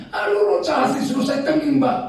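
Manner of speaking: high-pitched put-on excuse; mock complaining. Courting couple blaming evil spirits, mock high complaint.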